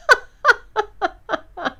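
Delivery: high laughing burst; burst of laughter. Real, not acted. Telling the birth story, she bursts out laughing. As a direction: laugh out at the memory.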